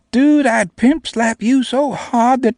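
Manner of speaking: put-on high, loud and slow teasing girl voice; slow mocking girl. A narrator's reading. Namie taunting back after laughing, high and slow. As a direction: drawl the taunt in a tiny high voice.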